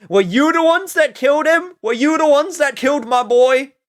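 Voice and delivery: high, loud accusing wail; hammed-up grief at chat; mock outraged accusation. Streamer accuses chat of killing her boy, hammed-up outrage.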